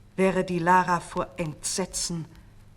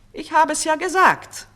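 much higher and louder gloating crow; triumphant; 10 semitones higher, 10 dB louder than usual. A narrator's reading. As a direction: crow over her victory. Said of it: "triumphierte die falsche Königin".

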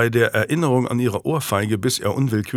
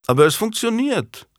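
a much higher, breezy royal retort; unimpressed retort; 11 semitones higher than usual. A narrator's reading. King shrugging off Warwick's warning, breezy and high. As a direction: brush the warning off lightly. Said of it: "entgegnete der König unbeeindruckt".

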